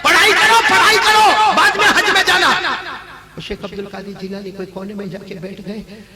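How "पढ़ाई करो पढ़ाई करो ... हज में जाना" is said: high, loud scolding mother; shrill-free loud maternal rebuke; angry mother. Acted. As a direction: scold the boy sharply to sit and study. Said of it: "माँ का गुस्सा अभी भी खत्म नहीं हुआ".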